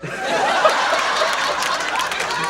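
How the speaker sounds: long, real wheezing laugh; cracking up. Real, not acted. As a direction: burst out laughing at the punchline. Cracks up after punchline.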